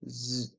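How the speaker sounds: "z" alone as a soft voiced buzz; isolated Z sound. Shown as a demonstration. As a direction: hum a quiet, low "z". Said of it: "Le S se prononce Z".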